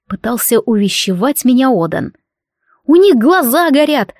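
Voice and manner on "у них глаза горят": loud cutting retort; sharp retort. Acted. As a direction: snap it back loudly. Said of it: "отрезала я".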